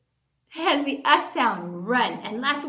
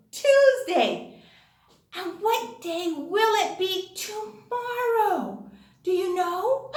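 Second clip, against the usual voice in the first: ordinary talk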